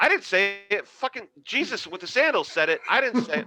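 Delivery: high, loud mock protest; blurting a denial, playing it for laughs. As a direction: deny it in a hammed-up high voice. Acted protest to angry comedian.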